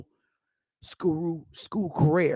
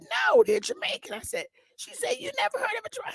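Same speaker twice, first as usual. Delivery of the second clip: mock high-pitched mother; imitating mother; faster than usual. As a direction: squeak out mom's words in falsetto. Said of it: "my mother was like".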